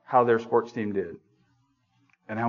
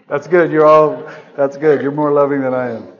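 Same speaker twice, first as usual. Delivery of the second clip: mock praise; 9 dB louder than usual. Sarcastic aside to congregation.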